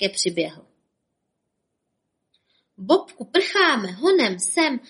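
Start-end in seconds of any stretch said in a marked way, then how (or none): 2.8-4.8 higher, slow, loud call to flee; urgent shout. Acted. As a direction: yell for your friend to run. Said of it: "křikl Bob".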